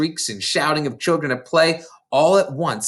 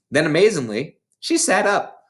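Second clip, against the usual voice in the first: plain reading voice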